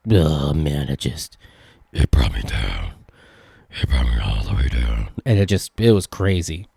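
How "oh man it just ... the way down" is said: much lower, dragging into a gloomy slump; hammed-up despair in a much lower voice; mock gloomy low voice. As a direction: sink the voice down like all hope is gone. Describing salty fries letdown.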